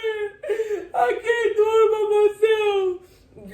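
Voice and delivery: high, slow sobbing wail; retold sobbing cry for help; crying plea. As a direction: beg through tears, breaking. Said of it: "I broke down crying".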